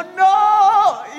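high, loud, sudden no; desperate yell. Retold or imitated. Preacher reliving a wave crashing on him, loud desperate no. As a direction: cry out as the wave hits.

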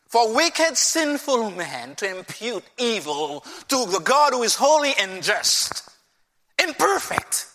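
ordinary talk, nothing marked

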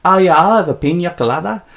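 high mimicry, pina said "peen-ya"; affected Spanish pina. Storyteller mimics girlfriend ordering at the bar, affected "peen-ya" pina. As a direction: put on her showy "PEEN-yah colada". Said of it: "So she said, like".

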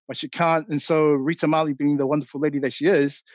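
normal talking voice

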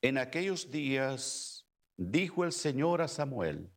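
normal talking voice

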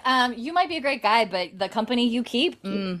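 lightly hammed-up disapproving look-down; mock judgmental Claire. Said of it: "you're like".

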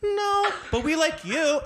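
very high slow mock-sweet reply; sugar-coat the rejection; hammed-up high prim couple. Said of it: "made a face".

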